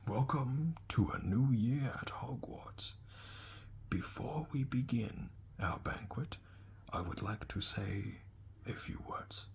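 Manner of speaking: slow, hushed, whimsical whisper; a quirky old headmaster speaking softly; Dumbledore whisper. Acted. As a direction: murmur the nonsense words like a secret. Dumbledore's speech.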